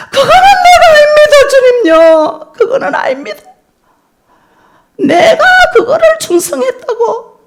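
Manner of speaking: high, loud sobbing plea; crying prayer. Retold or imitated. Mother crying out in prayer, high loud sobbing. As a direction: cry out to the Lord through tears. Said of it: "하고 제가 막 울었습니다".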